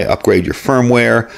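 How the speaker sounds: ordinary talk, nothing marked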